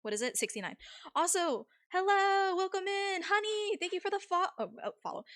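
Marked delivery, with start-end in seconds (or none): 1.1-4.5 high, sweet streamer greeting voice; chirpy cute welcome for the raiders; cute anime streamer. Acted. Greeting incoming raid on stream.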